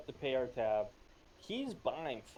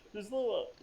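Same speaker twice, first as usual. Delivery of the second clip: ordinary delivery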